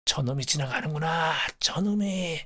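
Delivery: put-on high, agitated old man's cry; trembling grandfather. Dying grandfather hears the hated train passing, agitated cry. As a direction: cry out in dread, pitch climbing. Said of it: "와들와들 떨기 시작하셨어요".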